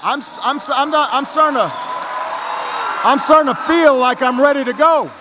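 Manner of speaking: building into a loud, high, excited shout; getting fired up. Acted. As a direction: let the energy take over until you're yelling. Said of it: "I'm starting to get kinda fired up".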